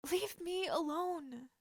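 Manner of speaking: tearful plea, voice cracking; crying. Acted. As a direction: cry it out through tears. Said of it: "She was crying".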